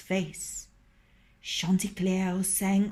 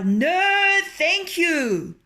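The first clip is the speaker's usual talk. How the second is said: much higher, loud crowing refusal; mocking rooster; 9 semitones higher, 7 dB louder, much slower than usual. A narrator's reading. Rooster taunts fox from the branch, triumphant. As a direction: throw it down gleefully.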